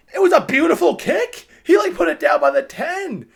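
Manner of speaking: high, loud, thrilled climax; real excitement. Real, not acted. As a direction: shout it like the play just happened. Climax of retelling the amazing kick.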